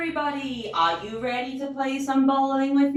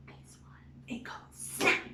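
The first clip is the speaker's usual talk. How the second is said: whispered aside; 11 dB quieter than usual. Acted. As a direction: whisper it softly. Whispered aside about the alligator.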